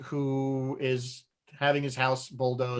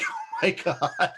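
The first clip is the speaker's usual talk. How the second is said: higher, laughing mock astonishment; mock freak-out; 5 semitones higher than usual. Host retells readers' reaction to forty-two, mock freak-out. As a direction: imitate people freaking out, laughing through it. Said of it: "freaking out".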